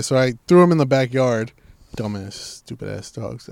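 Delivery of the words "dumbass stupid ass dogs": low, slow, quiet grumble; retold grumbling. Owner after putting dogs in the backyard, grumbling quietly. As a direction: mutter it under your breath, drawn out.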